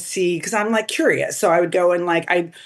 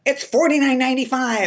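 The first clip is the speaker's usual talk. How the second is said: mock sales pitch; 8 semitones higher than usual. Mocking typical agent pitch.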